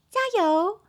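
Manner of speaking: high, loud arcade-machine cheer; claw-machine cheer. Shown as a demonstration. Teacher cheers tired students, high claw-machine voice. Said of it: "你好像夹娃娃机哦".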